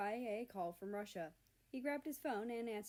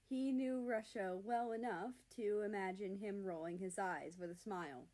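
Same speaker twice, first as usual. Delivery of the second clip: ordinary delivery, nothing marked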